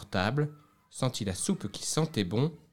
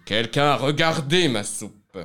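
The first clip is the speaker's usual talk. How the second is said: big bear's voice; 4 semitones higher, 8 dB louder than usual. Acted. Big bear voiced in story.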